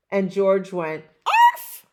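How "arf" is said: very high loud puppy yip; bark. Acted. Puppy George finally barking, high and happy. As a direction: yip it like a tiny excited pup.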